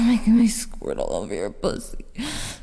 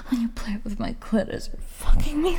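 aroused whisper; faster than usual. Acted. Aroused breathy delivery.

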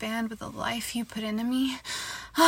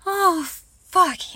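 ecstatic moan; 7 semitones higher, 6 dB louder than usual. Acted. Moaning during sex.

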